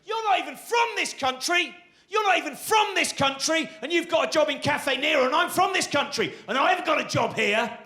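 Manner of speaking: high, furious ranting yell; aggressive shouting. Retold or imitated. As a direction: rant at the barista about jobs, pitched high. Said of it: "started shouting more aggressively and he went".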